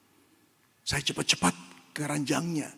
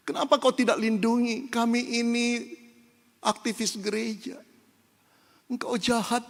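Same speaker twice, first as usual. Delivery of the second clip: higher, a hint of bitter reproach; mock complaint to God; 8 semitones higher than usual. Believer accuses God of being cruel, lightly voiced. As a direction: voice a hurt believer blaming God. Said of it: "ketika marah kepada Tuhan".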